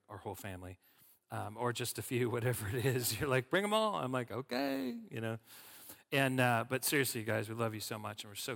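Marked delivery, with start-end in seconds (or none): 3.5-4.0 higher, louder mock-enthusiastic invite; breezy invitation. Acting out hosts' breezy invitation. 4.3-4.9 very high, sing-out okay; playful agreement. Retold or imitated. Acting out own reply to invitation.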